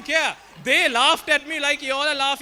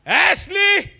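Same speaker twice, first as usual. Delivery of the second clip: Ashley called out higher and louder; belted Ashley; 7 semitones higher, 6 dB louder than usual. Retold or imitated. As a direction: belt the name Ashley with full force. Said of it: "I belted with the voice in me".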